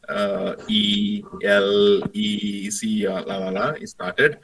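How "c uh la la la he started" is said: ordinary talk, nothing marked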